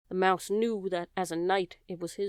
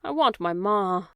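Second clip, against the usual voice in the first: slow, pleading cry; a child crying for her mother, slowly; 6 dB louder than usual. Acted. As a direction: draw it out as she starts to cry. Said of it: "cried Meg".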